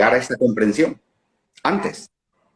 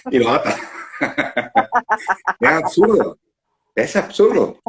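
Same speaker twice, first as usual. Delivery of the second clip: high-pitched, helpless laughter breaking into the words; laughing hard; 12 semitones higher than usual. Real, not acted. Speaker amused by the absurdity of taking it all along, laughing. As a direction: laugh through it, voice shooting up.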